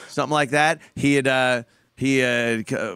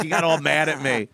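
normal talking voice

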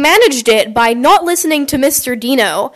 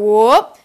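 short whoop sliding upward; rising whoop. Retold or imitated. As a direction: imitate a chair tipping with a whoop. Imitates kids tipping their chairs.

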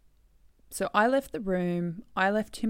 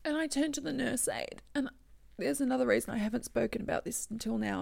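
ordinary talk, nothing marked

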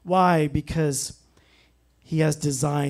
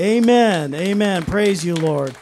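loud, raised amens easing off; exclaiming praise; 2 semitones higher, 5 dB louder than usual. Real, not acted. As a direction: burst into praise, then settle. Preacher breaks into praise mid-sermon.

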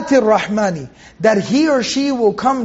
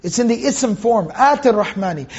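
ordinary talk, nothing marked

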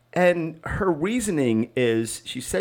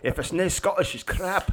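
put-on Scottish accent; 7 semitones higher than usual. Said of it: "That was more German".

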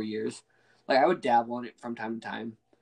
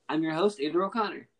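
a little higher, announcer-style host intro; podcast host intro; 5 semitones higher than usual. Shown as a demonstration. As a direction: open the show like a radio host. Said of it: "retaken intro, announcer style".

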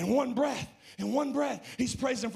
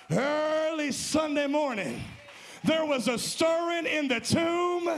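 full preaching shout; 7 semitones higher than usual. Acted. Resurrection climax, shouted.

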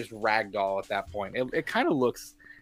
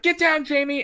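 much higher, urgent call; mock shouted warning; 12 semitones higher, 3 dB louder than usual. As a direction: yell a warning across the scene. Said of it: "and they're like".

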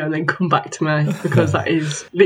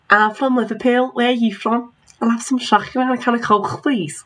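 higher-pitched put-on Scouse; Liverpudlian accent; 4 semitones higher than usual. Shown as a demonstration. Performing on guest's request, put-on Liverpudlian accent. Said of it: "Give us a Liverpudlian".

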